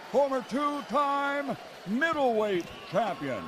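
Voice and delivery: usual speaking voice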